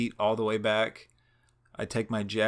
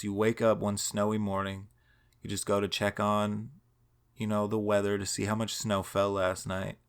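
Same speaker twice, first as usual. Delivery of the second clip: normal talking voice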